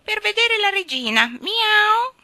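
very high, loud cat voice; insistent cat. Acted. Cat continuing as if deaf to the king, insistent. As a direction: carry on over him, ending in a yowled mew.

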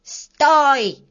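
high, drawn-out yell to stop; cross little man's high cry; angry shout. A narrator's reading. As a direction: shout him down crossly, stretching the words. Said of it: "сердито закричал Рыжий".

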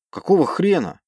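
sharp angry question; indignant outburst. Acted. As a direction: snap it out in disbelief. Officer outraged at bridge.

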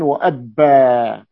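slow وأبا with a long, doubled b. Shown as a demonstration. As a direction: hold the ب in وأبا, then stretch the final vowel. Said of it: "بتشديد الباء".

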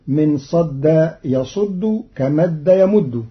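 usual speaking voice

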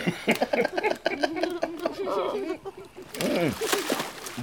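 a long, high, rising cackle; cackling at his own bawdy boast; high rising laugh. Acted. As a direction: laugh up high, pleased with himself.

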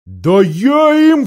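high, loud, drawn-out threat; boy's hot-headed shout; crying out. A narrator's reading. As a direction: burst out defiantly, stretching the words. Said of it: "вскричал Корин".

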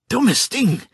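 high-pitched angry cry; wütender Ausruf. A narrator's reading. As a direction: shout it as a furious insult. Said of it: "rief sie". (wütend und hoch rufen)

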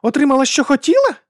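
high, loud disbelief; incredulous outburst. A narrator's reading. Woman cannot believe what the duke says, incredulous. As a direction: throw the words back as an outraged question. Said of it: "Вона не могла повірити в те".

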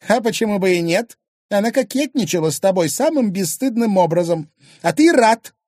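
high, loud accusing rant; jealous outrage. Acted. Wife jealous of a flirting rival, outraged.

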